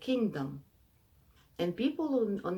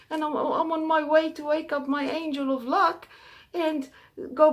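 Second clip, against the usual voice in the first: high, pleading voice of a poor traveller; pleading poor man; 11 semitones higher, 8 dB louder than usual. Acted. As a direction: beg for your life in a much higher voice.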